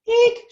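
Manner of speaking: very high, loud eek; child's shriek. Acted. Little Pancake shrieks in fright, very high. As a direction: squeal in fright. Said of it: "shouted Pancake".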